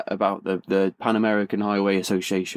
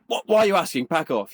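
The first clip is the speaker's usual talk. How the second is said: mock irritated brush-off, pitched up; retold hostile suspicion; 8 semitones higher than usual. Biker rebuffs a friendly stranger, mock hostile. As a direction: act out a wary, defensive rebuff. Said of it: "an immediate wall of, of fury".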